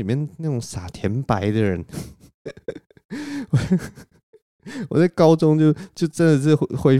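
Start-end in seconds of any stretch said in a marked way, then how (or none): 3.1-4.0 high giggle. Real, not acted. Laughing at own high-school self.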